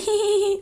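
sample laugh. Shown as a demonstration. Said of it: "You'll hear me going".

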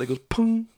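high, comic sound effect for the bounce; mock pung bounce sound. Retelling the saber hitting the shield, high mock pung. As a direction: squeak out the pung like a cartoon.

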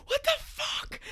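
very high, loud mock shriek; retold scream. Sleepwalker slamming into the table, high mock scream. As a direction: imitate a scream after crashing into something.

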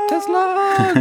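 high, loud triumphant shout; excited call. Real, not acted. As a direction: cheer the answer out. Echoing the quiz answer.

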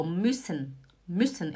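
"müssen" with a quick, short ü; short vowel. Shown as a demonstration. Teacher showing the double consonant rule, short quick vowel. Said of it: "você sabe que é rápido".

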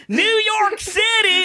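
very high, drawn-out mock cry; caricatured chorus of appalled men; mock outrage. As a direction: stretch it out in high, exaggerated disbelief. Mimics salsa advert outcry.

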